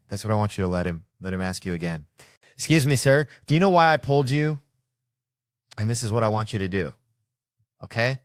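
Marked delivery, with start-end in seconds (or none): 2.5-4.6 loud, higher repeat of the question; impatient cop. Retold or imitated. Cop repeating his question to the driver, louder and higher. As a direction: push the question harder.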